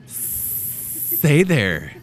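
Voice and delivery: playful put-on snake voice; talking snake. Snake offering to sell the house, put-on creature voice. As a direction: play a snake making a sales pitch. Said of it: "if snakes could talk".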